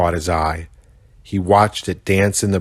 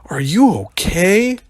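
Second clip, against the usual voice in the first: worried; 11 semitones higher than usual. Acted. Rhino worried for bush baby.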